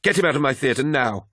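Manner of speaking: high, tight, angry order; hissed. A narrator's reading. Apothecary wants the First Captain gone, hissed. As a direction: order someone out in strained indignation. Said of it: "hissed Vaden".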